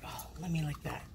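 soft breathless whisper for the dog. Pretend. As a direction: sneak the dog's wish in a whisper. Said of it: "She's like".

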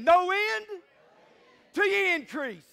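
very loud, high-pitched rallying cry; emphatic proclamation. Shown as a demonstration. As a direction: belt it out to rouse the congregation. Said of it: "I'm gonna say it again".

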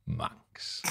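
whispered aside; under breath. Retold or imitated. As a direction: whisper it like a sly dig. Muttered caravan-neighbour joke.

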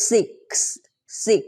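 "six" with a distinct k-s hiss at the end; final k-s sound. Shown as a demonstration. Teacher shows learners the ending sound, careful pronunciation. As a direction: finish "six" on a separated, crisp k-s. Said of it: "ข้างหลังนะคะ มันจะเป็น".

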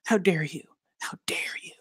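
hushed snarl, fading into a whisper. A narrator's reading. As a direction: snarl it under the breath. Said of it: "She snarls".

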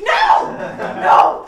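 loud, high angry "No!"; furious shout. Acted. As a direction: cut him off with a yell. Furious friend cuts him off.

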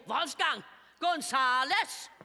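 shouted comic flourish; grand name announcement. Acted. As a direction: proclaim the name as a bold punchline. Acted suitor announcing grand name.

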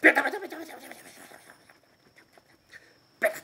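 high, fast rattled-off slapping noise; rapid slapping patter. Retold or imitated. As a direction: spit out the slaps like a drumroll. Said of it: "思いっきり叩くの". (高く速いベタベタの擬音)

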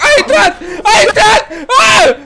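very high, loud shrieks; game-play terror shrieks; panicked screaming. Pretend. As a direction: scream as it breaks in. Monster breaks in, screaming.

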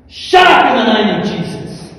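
loud, high rebuke; commanding shout. Retold or imitated. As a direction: re-enact a street command at full voice. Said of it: "I've commanded people".

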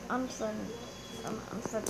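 sheepish real apology; fading apology. Real, not acted. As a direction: mumble the sorry down to nothing. Apologising after cancelling.

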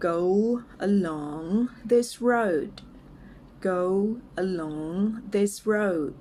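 word-by-word model for repeating, with pauses; slow and clear. Shown as a demonstration. English teacher models a phrase for learners, slow and clear. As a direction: say it slowly, each word apart. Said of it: "ลองฝึกออกเสียงตามนะคะ".

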